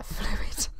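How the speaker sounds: whispered giggle on the word; laughing whisper. Real, not acted. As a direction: laugh it out under breath. Laughing at own slip.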